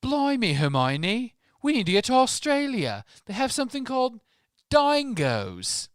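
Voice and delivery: high, loud hammed-up Australian accent. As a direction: ham up an Aussie accent, way up high. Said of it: "that's why I did the Australian accent".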